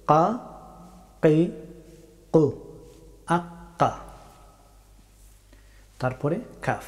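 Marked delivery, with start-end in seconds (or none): 0.1-3.9 very back of the tongue at the uvula on "কা, কি, কু"; Arabic qaf. Shown as a demonstration. Teacher showing the difficult qaf, far-back k. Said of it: "জিহ্বার একেবারে শেষের অংশ".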